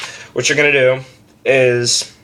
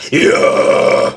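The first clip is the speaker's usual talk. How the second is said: breathy mid-range scream; 5 dB louder than usual. Shown as a demonstration. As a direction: rasp out the yeah in a mid scream.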